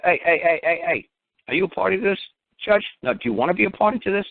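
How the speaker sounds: high, repeated cut-in, acted; challenging interruption. Litigant cutting off the judge in court, challenging. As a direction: jump in high to stop the judge.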